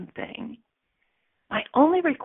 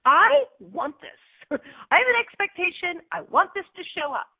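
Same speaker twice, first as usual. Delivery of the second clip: put-on childlike wanting; 8 semitones higher than usual. Voicing raw wanting.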